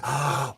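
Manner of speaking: sharp audible intake of breath; sudden realization gasp. Real, not acted. Host struck by a new idea about vibrations, realization gasp.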